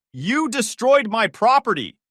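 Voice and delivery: loud, slow, high yell; shouting at the trespasser; slow furious shout. Acted. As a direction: hammer every word of the accusation. Joyce yells.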